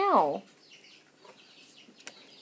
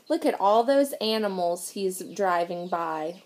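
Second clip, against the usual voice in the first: plain reading voice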